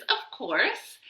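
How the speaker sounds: plain reading voice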